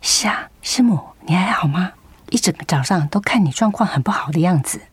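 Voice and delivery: hushed whisper in church. Acted. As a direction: whisper so the service is not disturbed. Whispering during church service.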